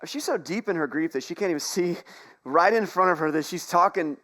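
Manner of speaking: usual speaking voice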